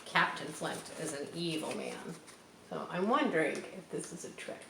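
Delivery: ordinary delivery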